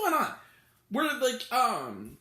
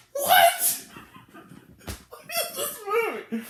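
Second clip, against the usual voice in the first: very high, loud "what" collapsing into laughter; flabbergasted; 13 semitones higher, 3 dB louder, slower than usual. Real, not acted. Critic reacting to a cruel scene, high flabbergasted laughing cry. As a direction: squawk in disbelief, then laugh through it. Disbelief after retold scene.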